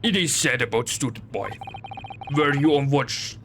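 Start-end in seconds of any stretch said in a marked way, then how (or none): 0.0-1.5 gruff Russian sailor, proud; broken English with a heavy Russian accent. Acted.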